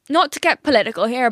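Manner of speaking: ordinary delivery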